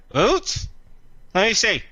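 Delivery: a greedy little beak perking up at the boots; beak creature voice. Acted. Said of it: "He says, the beak says".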